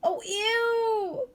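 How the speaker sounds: high, squealing "ew"; disgusted cry. A narrator's reading. Annabelle sees the cage of crickets, high disgusted cry. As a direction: recoil from the crickets. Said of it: "cried Annabelle".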